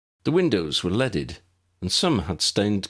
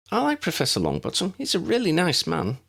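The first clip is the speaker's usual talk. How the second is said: put-on young girl voice; 10 semitones higher than usual. Lydia supporting Abby.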